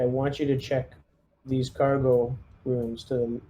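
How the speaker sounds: normal talking voice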